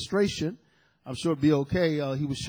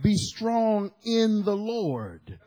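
slow, weighty charge; slow exhortation; 2 semitones higher, much slower than usual. Real, not acted. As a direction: urge them slowly and firmly. Preacher repeats exhortation.

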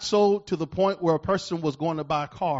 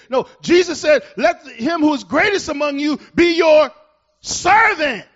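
preaching at full force; 12 semitones higher, 11 dB louder than usual. Real, not acted. Pastor thundering at the congregation, preaching at full force. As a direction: proclaim it to the whole room.

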